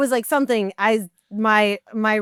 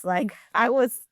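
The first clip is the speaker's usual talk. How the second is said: ordinary delivery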